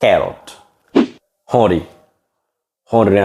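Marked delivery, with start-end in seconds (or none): 0.0-0.4 ক্যারট with an open "kae" vowel, falling; ক্যারট as the corrected version; correct English pronunciation. Shown as a demonstration. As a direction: model ক্যারট once, vowel as in "cat". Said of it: "খারট ন হরিম".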